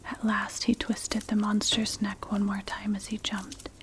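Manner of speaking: ordinary delivery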